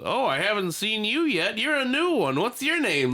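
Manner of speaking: old man impression. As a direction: coo at the dog like the chatty old man. Imitating the old man's greeting.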